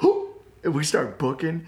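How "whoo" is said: short, very high, loud "whoo"; startled whoop. Retold or imitated. Storyteller re-enacting friends caught in the act, high startled whoop. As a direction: let out a sudden high hoot. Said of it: "we were like".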